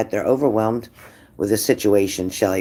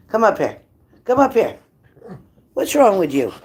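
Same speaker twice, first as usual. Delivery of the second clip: much higher pleading call to the dog; calling her dog up in a high coaxing voice; 8 semitones higher than usual. Real, not acted. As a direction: lift the voice high to coax the dog.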